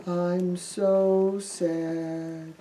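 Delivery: very slow, soft, low moan; mopey Thomas. Acted. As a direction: drag out the sadness in a sulk. Thomas moping.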